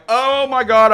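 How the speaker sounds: seeing a man who just smoked crack drive off; shocked. Retold or imitated. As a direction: gasp it out in alarm. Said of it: "I'm like".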